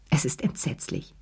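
soft, low inward horror; inward thought. A narrator's reading. Lea silently appalled at what she sees, hushed thought. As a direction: think it to yourself, hushed and low. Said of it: "dachte Lea".

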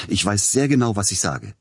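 high-pitched, indignant insistence; snapping angrily. A narrator's reading. Composer defending himself to agent, angry outburst. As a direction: insist heatedly. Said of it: "fuhr Peter Iljitsch ihn an".

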